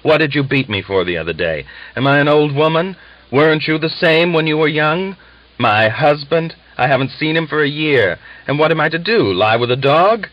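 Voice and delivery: woman yelling at her father-in-law, loud angry outburst; shouting. A narrator's reading. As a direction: throw it in his face at full voice. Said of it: "shouted into the frenzied old man's face".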